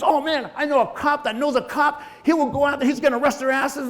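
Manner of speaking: high, heated imitation of a protective brother; outraged brother. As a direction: act out indignant promises of payback. Another brother promising police action.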